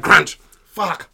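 sudden loud high outburst; loud crunch and curse. Retold or imitated. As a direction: bite down, then curse loudly. Acts out biting the lolly.

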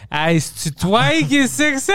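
hammed-up French seducer; mock French accent. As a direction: draw out the French seduction line. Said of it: "You should have used the accent".

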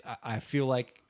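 normal talking voice

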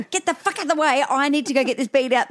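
high, rushed swearing demand; frantic impatience. Retold or imitated. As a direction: push past someone in a retold panic. Frantic mother pushes past doorman.

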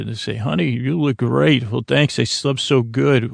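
usual speaking voice

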